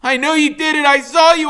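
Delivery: shrieking at the top of his lungs, high and loud; a boy screaming at the man choking Loretta. Acted. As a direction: scream it over and over, desperate. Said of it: "so all I can do is keep screaming".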